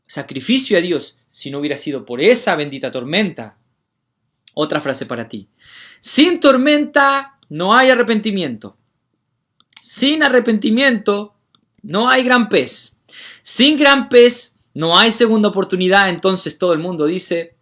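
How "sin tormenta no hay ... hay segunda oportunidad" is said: loud, high declamation; preaching at full force. Acted. Preacher giving the congregation a phrase, loud and high.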